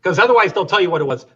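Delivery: ordinary talk, nothing marked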